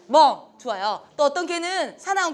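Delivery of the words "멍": loud playful bark; a short cheerful woof; cute dog bark. Shown as a demonstration. Teacher showing the dog's bark to the class, loud bark. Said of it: "걔는 어떻게 짖을까요?".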